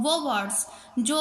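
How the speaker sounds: normal talking voice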